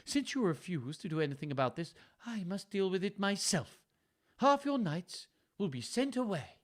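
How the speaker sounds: lightly voiced cold daughter; stern Goneril. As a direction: scold the old father. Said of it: "said Goneril".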